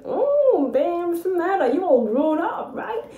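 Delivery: high, wide-eyed impressed tone; impressed flirt. Retold or imitated. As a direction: swoon over how she's grown. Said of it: "he's looking at me like".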